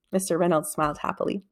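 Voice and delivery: ordinary delivery, nothing marked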